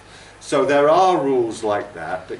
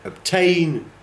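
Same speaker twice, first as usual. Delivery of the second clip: obtain with high, falling stress on TAIN; second-syllable stress; 8 semitones higher than usual. Shown as a demonstration.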